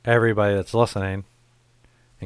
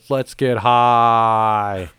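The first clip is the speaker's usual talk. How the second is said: mock lyric delivery; 4 dB louder, much slower than usual. Quoting the band's lyrics.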